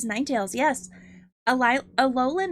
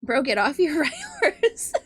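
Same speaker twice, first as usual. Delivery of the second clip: laughing through a tease; bursting out laughing mid-jab; 4 semitones higher, 5 dB louder than usual. Real, not acted. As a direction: tease him, cracking up.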